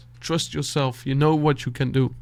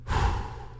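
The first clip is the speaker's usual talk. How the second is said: quiet sigh; 5 dB quieter than usual. Real, not acted. Pausing in heartfelt advice.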